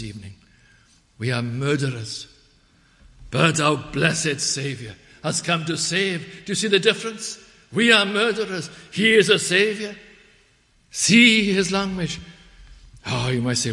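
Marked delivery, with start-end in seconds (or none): 3.3-12.2 loud, high, emphatic sermon delivery; full-force preaching. Real, not acted. Preacher contrasts murderers with Saviour for congregation, preaching forcefully. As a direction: drive the contrast home at full voice.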